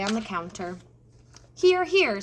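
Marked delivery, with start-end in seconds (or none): 1.5-2.2 high, loud bossy cook; cook calling out. A narrator's reading. Cook calling Mig back to the tray, loud and high. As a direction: call it out like a bossy kitchen cook. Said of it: "said cook".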